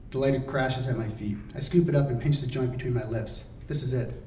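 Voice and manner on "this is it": ordinary delivery, nothing marked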